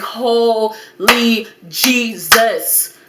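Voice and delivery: very slow, each syllable stretched out; stunned disbelief. Retold or imitated. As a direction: let the shock land syllable by syllable. Stunned at being pulled over.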